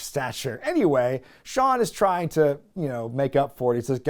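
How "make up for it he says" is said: ordinary delivery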